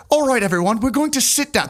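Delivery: high-pitched imitation of a British friend; British accent. British friend settles thirty hidden guests, high mock British accent. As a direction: address a group in a mock British voice. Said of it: "my friend who's British".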